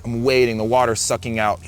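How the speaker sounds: ordinary talk, nothing marked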